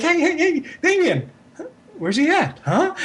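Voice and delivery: put-on high, bewildered dog calling for its missing boy. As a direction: voice the confused family dog looking for the boy. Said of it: "the dog's like".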